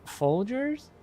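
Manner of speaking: a hesitant brand guess with a lift at the end; unsure guess. Retold or imitated. Retelling the same guess.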